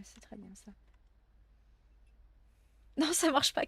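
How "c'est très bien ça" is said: whispering. Real, not acted. Aside while looting bandages.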